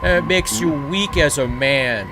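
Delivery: hammed-up macho scorn; sarcastic macho. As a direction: sneer at men crying, all sarcasm. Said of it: "That's all sarcasm".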